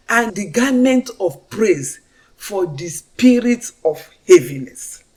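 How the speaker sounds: slow, loud preaching of scripture. Real, not acted. Testimony speaker proclaiming God's promises to the congregation, slow loud preaching. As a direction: proclaim each promise slowly and loudly.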